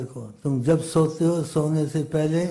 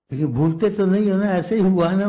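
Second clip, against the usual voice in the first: eager questioning; 6 semitones higher, 7 dB louder, faster than usual. Acted. Merchant eagerly asks again.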